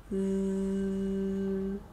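soft, low sleepy snore; snoring sound. Acted. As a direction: let out a quiet snore. Cat fast asleep.

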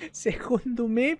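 ordinary talk

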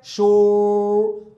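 शोर held very slowly, the श stretched out; long drawn sh. Shown as a demonstration. Teacher modelling the sound for the class. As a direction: hold the श of शोर for a long time. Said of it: "वो रोल कर रहा है कि नहीं कर रहा है?".

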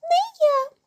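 very high little mouse voice; squeaking mouse. A narrator's reading. Mouse Krut refuses to knead, squeaking. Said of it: "пищить круть".

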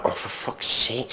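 unvoiced for fuck's sake; muttered exasperation. Real, not acted. Reviewer mutters at the contradictory lyric. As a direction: mutter it in despair.

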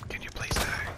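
whispered polite request; mock ASMR whisper. Player asks the monster to die, whispering. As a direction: whisper it sweetly at the enemy. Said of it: "If you guys like the A S M R".

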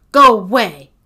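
loud, gruff dismissal. A narrator's reading. Agatha shouts through the door at Sophie, gruff. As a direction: send the visitor off gruffly, at full volume. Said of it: "came the gruff voice".

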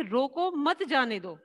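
"रोको" on its own, then "मत जाने दो" together; pause after रोको. Shown as a demonstration. Teacher explains calling someone back, pause after "रोको". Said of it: "इसमें पहले में क्या कहा गया है".

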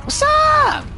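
squeaky high put-on "What's up?"; mock squeaky greeting. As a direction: squeak it out as a silly taunt.

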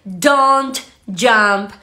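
drawn-out teaching delivery with clear breaks; slow repeat-after-me model. Shown as a demonstration. Teacher asking learners to repeat along, slow model. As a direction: say it very slowly, word by word. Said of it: "repitan conmigo".

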